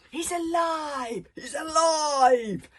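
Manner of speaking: big, slow, high triumphant shout; father roaring that his son lives. A narrator's reading. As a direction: bellow it out in triumph. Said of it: "shouted out Stoic in triumph".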